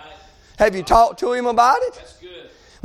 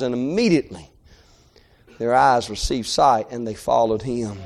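low, soft, hushed reading; soft scripture reading; 8 semitones lower, 4 dB quieter than usual. Acted. Preacher reading the healing passage aloud, low and hushed. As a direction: let the verse fall quietly.

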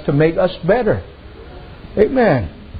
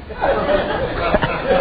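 breathy laugh; 3 semitones higher, 4 dB quieter than usual. Real, not acted. Laughing at own joke.

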